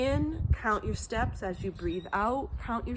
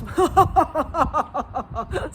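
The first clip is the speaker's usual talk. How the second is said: loud, high demonstrative guffaw; 6 semitones higher, 8 dB louder than usual. Shown as a demonstration. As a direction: let out a big staccato fake laugh, ha-ha-ha. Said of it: "you could guffaw, laughter".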